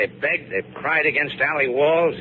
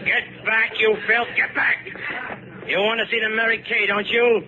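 high, sneering shout, fast on the taunt; shouting the refugees back with contempt; harsh command; 11 semitones higher than usual. Acted. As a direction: drive them back like cattle.

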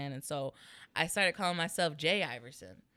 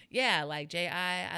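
normal talking voice

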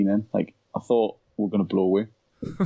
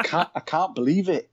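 full Yorkshire; 7 semitones higher than usual. Retold or imitated. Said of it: "I was just full Yorkshire".

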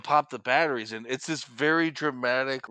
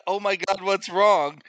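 higher, louder feigned concern; mock alarm; 6 semitones higher, 5 dB louder than usual. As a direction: play at being worried, pitch up. Mock worried reply to walkie call.

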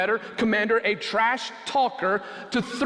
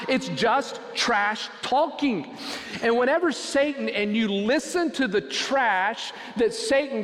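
rising sermon; 5 semitones higher than usual. Acted. Pastor urging the congregation, preaching at full force. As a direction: build the sermon to a passionate climb.

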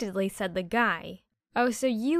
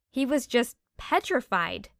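ordinary delivery

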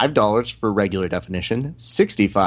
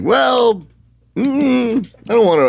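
hesitant quote; 11 semitones higher, 5 dB louder, slower than usual. Retold or imitated. Retelling reluctant fighter's hesitation.

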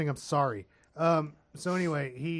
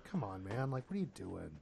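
low, soft, mild reproach; understated, quiet disappointment; mock low-key coaxing; 5 semitones lower, 11 dB quieter than usual. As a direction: imitate a chef gently chiding a cook. Calmer Ramsay of the original show.

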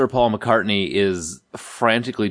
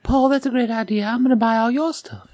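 high, breathy [name] impression; squeaky high pop star voice; 13 semitones higher than usual. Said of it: "right up underneath [name]".

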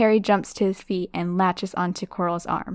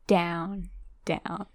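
fading away; 6 dB quieter than usual. Acted. Falling into blackness.

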